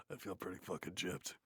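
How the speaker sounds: usual speaking voice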